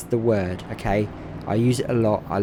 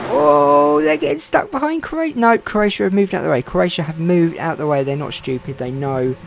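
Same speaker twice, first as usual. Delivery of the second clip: raised, driving play-by-play of the final laps; live race commentary; 4 semitones higher, 4 dB louder than usual. Acted. Commentator calling the final lap to viewers, eager. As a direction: call the race as it unfolds.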